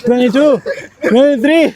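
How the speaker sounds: straining through the last reps; doing push ups. Real, not acted. Final reps of push-up set.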